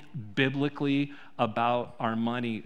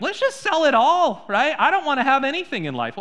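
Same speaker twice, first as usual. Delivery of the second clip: much higher, louder sarcastic mock voice; mock cheerful renouncer; 10 semitones higher, 6 dB louder than usual. Preacher mocking someone eager to sell everything, much higher and louder. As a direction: lampoon someone eager to give it all away. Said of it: "wakes up Monday morning like".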